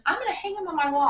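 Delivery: high, loud and quick, bubbling over; excited insisting. Retold or imitated. Waitress insisting she will hang the gift, fast excited. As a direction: rattle it off, too thrilled to listen.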